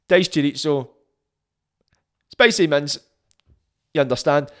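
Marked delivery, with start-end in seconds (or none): 0.1-3.0 each item spaced out with long gaps; a beat after diced chorizo, then spicy mince; slow with a beat between items. Shown as a demonstration. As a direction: read the order out slowly, pausing between every item. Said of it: "I go slow with my order".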